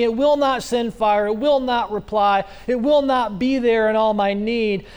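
ordinary talk

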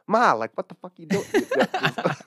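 put-on loud, high, fast Boston accent. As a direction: imitate the Boston father-in-law on the phone to his mom. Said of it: "his Italian accent and his Boston accent".

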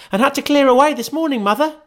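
put-on very high, fast girl's voice; a busy daughter explaining herself quickly; young girl. Martha voiced.

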